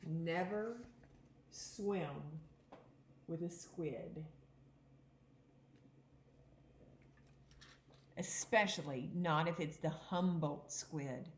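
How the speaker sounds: a clear, even read-aloud voice for the class; teacher reading a picture book aloud; reading aloud to children. Acted. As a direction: read the book to the kids, a touch dramatic.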